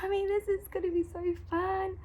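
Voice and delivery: high, slowed, near-tearful delight; a kid almost crying with happiness; overjoyed child. Retold or imitated. Mum imitating her son thanking her for the surprise, overjoyed.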